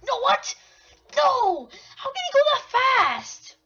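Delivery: high, very loud yelling; outraged disbelief. Real, not acted. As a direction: yell in shock at being caught. Enemy catches the player.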